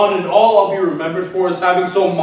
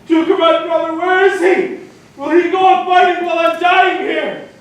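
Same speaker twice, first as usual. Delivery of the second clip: despairing outcry; 10 semitones higher than usual. Acted. Dying Ajax cries out for his brother, anguished. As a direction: call out in agony for your brother.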